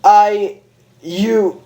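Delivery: lost for words; stuttering doctor. Acted. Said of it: "Sable stuttered".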